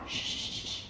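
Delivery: voiceless "shh" to quiet a son; hushing. Acted. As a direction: gently shush someone mid-sentence.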